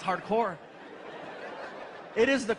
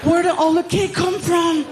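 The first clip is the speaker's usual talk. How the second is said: put-on much higher, sing-song half-asleep kid; Frankie voice; 8 semitones higher, 9 dB louder than usual. Frankie walking into the kitchen, sing-song and much higher. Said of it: "he's all".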